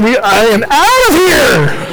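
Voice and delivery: high, drawn-out comic cry; mock alarm. Bystander fleeing the demoniac, comic alarm. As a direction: pitch it up as you bail out.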